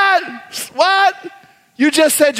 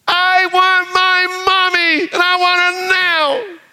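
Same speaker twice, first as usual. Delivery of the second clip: put-on high, slow, crying child demanding mommy; wailing child; 8 semitones higher than usual. Child crying for mommy, high and wailing. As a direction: whine it like a toddler wanting mama.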